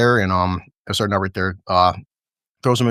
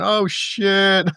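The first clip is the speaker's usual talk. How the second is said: stunned oh shit; 9 semitones higher than usual. Real, not acted. Reacting to the fall into water.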